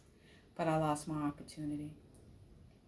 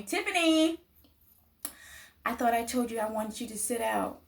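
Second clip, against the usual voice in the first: teacher calling out; 6 semitones higher than usual. Retold or imitated. Teacher calling her from recess.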